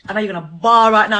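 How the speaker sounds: usual speaking voice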